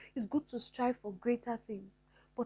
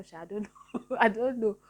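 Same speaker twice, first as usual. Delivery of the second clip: laughing it off; 5 dB louder than usual. Real, not acted. Laughs at her own remark.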